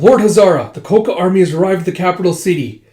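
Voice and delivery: loud, high, fast acted announcement; alarmed report. Messenger warning his lord of an army, alarmed shout. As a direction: call out urgent news to the lord.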